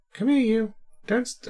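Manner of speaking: high cooing call to a pet. Real, not acted. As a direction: sweet-talk the cat over. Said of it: "I'm literally baby talking".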